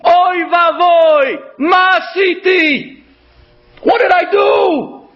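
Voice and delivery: high, drawn-out wail of horror; acted cry of "what have I done"; anguished scream. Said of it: "he starts to scream".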